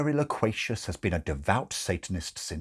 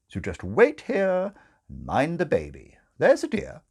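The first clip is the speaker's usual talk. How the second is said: high, bustling dismissive matron; brisk brush-off; 9 semitones higher, 4 dB louder than usual. Acted. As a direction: pat her on the head and move on. Grace brushing her off.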